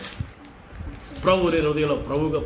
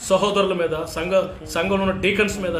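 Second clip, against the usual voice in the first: ordinary talk, nothing marked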